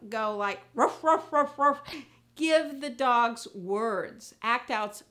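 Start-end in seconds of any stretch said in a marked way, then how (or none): 0.7-1.8 loud imitated dog ruffs; dog barking. Shown as a demonstration. How not to act out a dog, loud barking. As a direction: bark like a dog, loudly. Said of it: "when you act out a dog".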